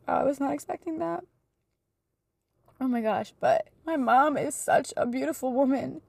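choked up, pitch climbing; real emotional. Real, not acted. Daughter praising her mom's contentment, suddenly emotional and tearful. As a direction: overcome with love while praising someone. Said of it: "I'm sorry if I get emotional".

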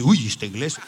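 a hint of a soft, startled member in the back; startled churchgoer. Preacher voicing a frightened churchgoer, softly startled. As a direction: lightly play the nervous pew-sitter. Scared churchgoer voiced in sermon.

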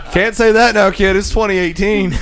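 laughing high and loud; scolding through laughter. Real, not acted. Laughing at clip's slur.

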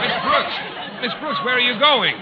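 high, loud, calling through laughter; calling out amid laughter; laughing call after her. Acted.

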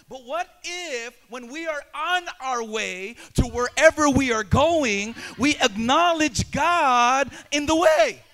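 loud, building preaching voice ending in a shout; full-force preaching. Acted.

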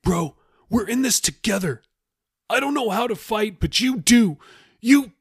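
very high, agitated plea; Jeffy, frantic. Acted. Jeffy pleads with Raoul to fight, frantic and high. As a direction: beg your shipmate to do the fighting.

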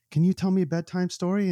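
put-on a young boy pleading for a story; child's voice. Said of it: "in his, his accent".

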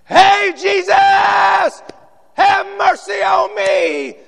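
slow, high, loud crying out; desperate shout. Acted. Bartimaeus crying out to Jesus, shouted high and slow. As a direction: cry out with everything you have.

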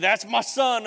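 loud, high, proud declaration; booming proclamation. Acted. Preacher voicing God over the congregation, loud and proud. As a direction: shout it like God announcing his son.

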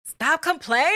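mock his stern order in a high voice; imitated snap. Wife mimicking husband's order in a high voice. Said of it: "you was like".

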